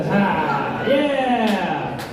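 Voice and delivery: ordinary talk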